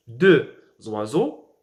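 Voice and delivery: "deux oiseaux" slowly, x as z opening "oiseaux"; liaison. Shown as a demonstration. Teacher demonstrating liaison, "deux oiseaux" slowly with linking z. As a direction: pause after "deux", start "oiseaux" with z. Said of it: "On appelle cette règle la liaison".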